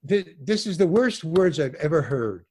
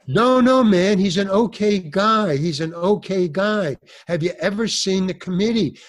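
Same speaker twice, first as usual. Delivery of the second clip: pleading; 3 semitones higher, 6 dB louder than usual. Retold or imitated. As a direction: beg him to spare your friend. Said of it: "Gino is pleading".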